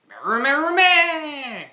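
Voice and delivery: very high, loud horse shriek; creature battle cry. Acted. Horse creature charges into battle with a loud high shriek. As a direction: shriek while charging forward.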